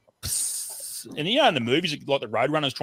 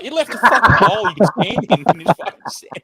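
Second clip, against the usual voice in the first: laughing; 5 semitones higher, 5 dB louder than usual. Real, not acted. Laughing while telling the blast.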